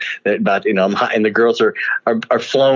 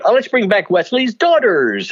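retold Bill Cosby; 11 semitones higher than usual. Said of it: "he would then say".

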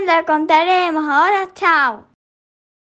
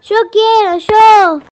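excited; 6 semitones higher, 8 dB louder, slower than usual. Acted. Kids clamouring for yerba.

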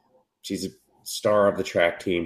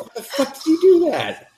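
very high, louder mock panic; shrill freak-out; 4 dB louder than usual. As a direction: screech it like a panicked cartoon boy. Boy freaking out in recap.

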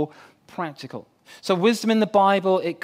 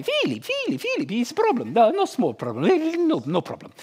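high Polish builder calling; Polish accent; 6 semitones higher than usual. Retold or imitated. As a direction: Polish builder calling several times a day. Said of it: "he's got a very strange accent".

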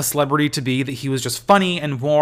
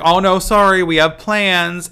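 higher, louder, slowing on the excuse; apologetic stranger; 7 semitones higher, 8 dB louder than usual. Retold or imitated. Stranger declines Leslie's show invitation, high and sheepish. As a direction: politely turn down the invitation. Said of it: "the guy was like".

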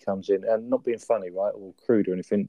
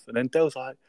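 ordinary delivery

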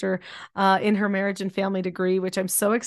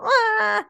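high whiny baby wail, mock; mock whiny cry; 13 semitones higher, 4 dB louder than usual. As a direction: imitate a fussy baby's whine. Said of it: "instead of to go".

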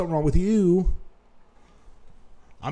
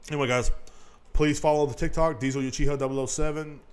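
ordinary talk, nothing marked

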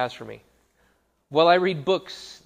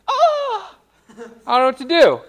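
loud, high-pitched panicked yelp; hammed-up fright at being asked to pray; mock panic; 13 semitones higher, 10 dB louder, slower than usual. Acting out people scared to pray.

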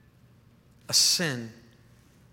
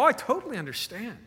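pitched way up, quick and eager; high eager reassurance; 9 semitones higher, faster than usual. Retold or imitated. Pastor to the caller, high eager reassurance. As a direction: gush it out in a high voice. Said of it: "And I go".

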